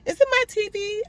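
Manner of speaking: high, puzzled squeak of disbelief; mock baffled viewer; confused disbelief. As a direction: sound like you can't trust your own TV. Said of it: "I was like".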